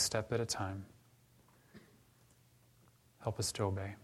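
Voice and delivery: soft, unhurried pastoral prayer; closing prayer. Real, not acted. Pastor praying for the congregation, soft and earnest. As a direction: pray it quietly, a humble request.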